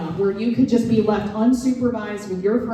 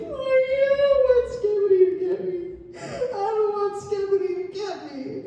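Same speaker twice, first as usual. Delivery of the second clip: put-on much higher, tearful kid voice; little boy voice; 13 semitones higher than usual. Son telling mom he fears Skibidi, high scared child voice. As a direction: play a scared five-year-old, pitched way up. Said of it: "And he's like".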